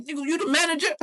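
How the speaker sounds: very high, incredulous demand; acted-out angry question; confrontational challenge. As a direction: challenge him in a high, heated voice. Acted quarrel with cook.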